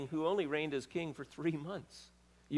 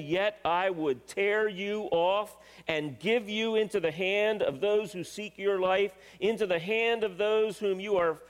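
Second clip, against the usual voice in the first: raised, loud declamation of judgement; preaching scripture at force; 5 semitones higher, 4 dB louder than usual. Acted. Preacher reads God's judgement to the congregation, loud declamation. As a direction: proclaim it like a prophet, high and forceful.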